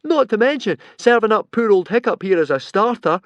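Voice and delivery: loud, high cold scolding. A narrator's reading. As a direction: tell him off scornfully. Fishlegs scolding Alvin.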